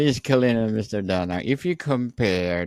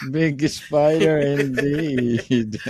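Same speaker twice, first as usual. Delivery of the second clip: slow, laughing echo of the punchline; amused echo; 7 semitones higher, 3 dB louder, much slower than usual. Real, not acted. Host repeating the story's punchline, amused and slow. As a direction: savour it with a chuckle. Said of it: "host echoes the punchline".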